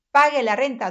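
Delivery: loud, firm command; a bossy shouted order. Shown as a demonstration. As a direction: demand the rent like a stern landlord. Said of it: "Imperativa es una oración que indica orden".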